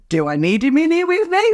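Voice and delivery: high-pitched, playful question rising at the end; joking. Retold or imitated. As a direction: ask it as an exaggerated joke. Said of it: "I said it like that, like joking".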